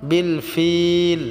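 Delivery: ফিল stretched for three alifs; slow বিল ফিল with a long held ee; long-held vowel. Shown as a demonstration. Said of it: "ফিলটা আমরা তিন আলিফ টেনে পড়ব".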